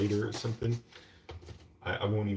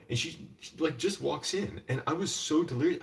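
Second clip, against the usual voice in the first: ordinary delivery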